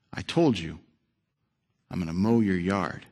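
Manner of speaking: steady and gentle, won't be shaken; quietly firm old neighbour; calm old man. Retold or imitated. Old man holds on calmly.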